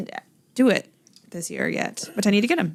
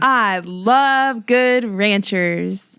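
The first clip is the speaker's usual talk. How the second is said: slow drawn-out; much slower than usual. Pretend. Ad read with laughter.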